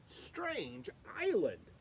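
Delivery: ordinary delivery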